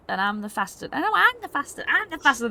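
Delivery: put-on high, loud kid one-upping another; excited childish bragging; competitive boasting kids. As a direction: squabble like kids, jumping up in pitch. Kids competing over who is fastest.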